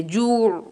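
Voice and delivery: जू with the ain pressed hard; stress on the ain. Shown as a demonstration. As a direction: lean on the ain in जू. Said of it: "आइन पे stress करेंगे". (जू में आइन पर ज़ोर देकर)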